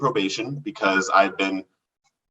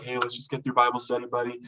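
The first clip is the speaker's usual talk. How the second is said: soft, quick reassurance; imitating a friend's quiet comforting; calming; 7 dB quieter, faster than usual. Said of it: "he calms me down".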